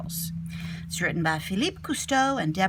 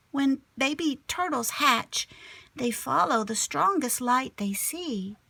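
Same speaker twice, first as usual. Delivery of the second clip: put-on kid explaining; 6 semitones higher than usual. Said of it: "I explained".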